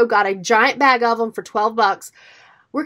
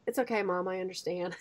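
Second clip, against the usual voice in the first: soft, much quieter comfort; reassuring son; 6 semitones lower, 13 dB quieter than usual. Retold or imitated. Oldest son pats mom's shoulder, soft and reassuring. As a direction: gently soothe the upset mom. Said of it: "patted my shoulder, and goes".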